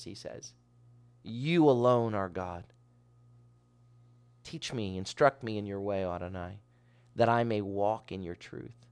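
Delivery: ordinary talk, nothing marked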